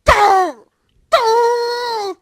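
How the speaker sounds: calling out loud across the room; shouting a name. Real, not acted. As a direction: yell the name to get attention. Calling out loudly to someone. (crier un nom)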